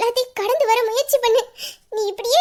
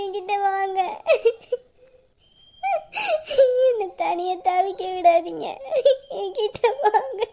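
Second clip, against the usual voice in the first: tearful longing. Acted. Widow longing for dead husband.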